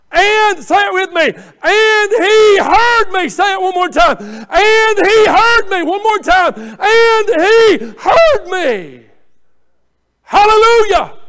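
high, loud, long-held words; full-force revival shout; shouted preaching. Real, not acted. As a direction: shout it out for the congregation to repeat, stretching each word. Leads congregation in shouted repetition.

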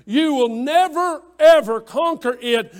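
forceful pulpit insistence; emphatic warning. Real, not acted. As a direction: drive each word home, high and loud. Preacher warns congregation emphatically.

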